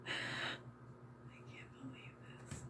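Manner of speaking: fast whispered aside to yourself. Real, not acted. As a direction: breathe it out, mortified. Mortified aside to herself.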